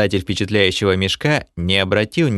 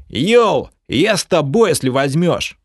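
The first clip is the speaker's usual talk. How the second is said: cheerful greeting; 7 semitones higher, 3 dB louder than usual. Acted.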